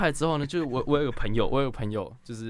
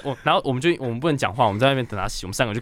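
ordinary talk, nothing marked

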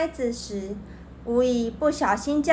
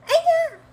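sudden high shriek of pain; painful shriek; 5 dB louder than usual. Acted. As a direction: yelp as the trap grabs your feet. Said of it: "惨叫一声". (狐狸被粘住时的尖声惨叫)

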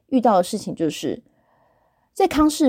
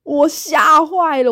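mock frightened gasp; 6 semitones higher, 4 dB louder, slower than usual. Retelling own fright.

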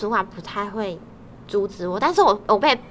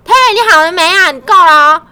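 loud annoyed call; 8 semitones higher, 14 dB louder than usual. Retold or imitated. Coworker yells to stop her.

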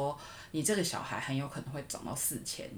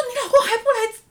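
much higher, loud disbelieving cry; outraged disbelief, pitched way up; shocked boss; 14 dB louder than usual. Retold or imitated. Boss shocked her husband isn't coming, high loud cry. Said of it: "然后我主管超紧张".

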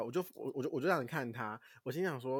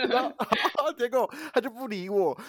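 laughing complaint; 11 semitones higher, 7 dB louder than usual. Real, not acted. 边笑边抱怨.

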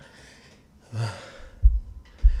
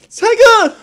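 much higher, loud excited call across the room; loud fan shout; 10 dB louder than usual. Retold or imitated. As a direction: yell the name like a thrilled fan. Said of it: "他就是这样".

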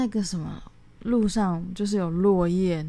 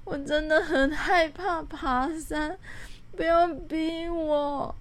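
higher, slow, whiny begging; retelling her crying refusal to climb, higher and slow; tearful plea; 9 semitones higher, slower than usual. Retold or imitated. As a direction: plead through mock tears not to be forced. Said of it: "默默地蹲在那边哭了".

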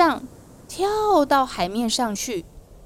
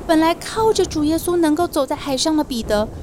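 ordinary delivery

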